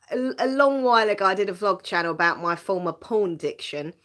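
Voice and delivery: usual speaking voice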